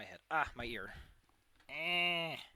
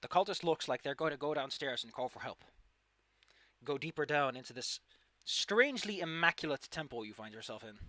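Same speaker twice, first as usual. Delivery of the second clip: usual speaking voice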